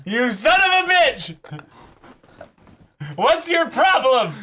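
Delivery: high, loud slowed-down mock yell; hammed-up outraged yell at the cohost; playful shouted insult, slowing on the question; mock fury.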